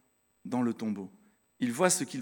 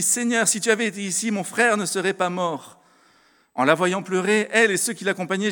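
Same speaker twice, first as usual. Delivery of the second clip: lecture biblique; 5 semitones higher, 6 dB louder than usual. Acted. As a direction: lecture de l'Évangile proclamée, plus aiguë et plus forte. (lecture solennelle, plus forte et plus aiguë)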